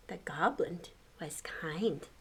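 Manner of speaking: plain reading voice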